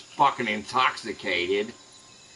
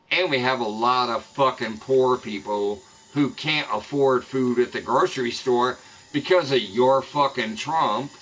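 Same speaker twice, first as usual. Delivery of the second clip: usual speaking voice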